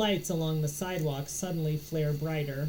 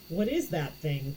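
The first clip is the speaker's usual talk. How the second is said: ordinary delivery, nothing marked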